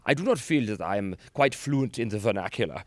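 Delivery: precise, mock-formal German accent. Comedian mimicking his German friend fretting over fluency, German accent. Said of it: "sounded precisely like every German stereotype".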